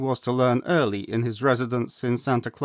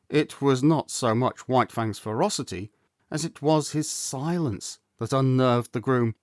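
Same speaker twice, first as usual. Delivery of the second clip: ordinary delivery, nothing marked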